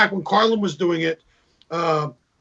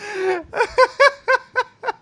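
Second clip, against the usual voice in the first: high laugh. Real, not acted. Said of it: "it's horrible".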